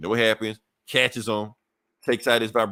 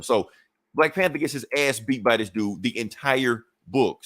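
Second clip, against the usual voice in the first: ordinary delivery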